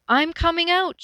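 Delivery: high, loud call across distance; calling loudly. Acted. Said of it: "she called loudly".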